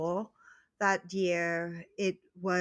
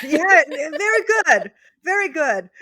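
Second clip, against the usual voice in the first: loud, high, delighted praise; excited praise; 8 semitones higher, 12 dB louder than usual. Real, not acted. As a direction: cheer her on, laughing. Praising the learner's pronunciation.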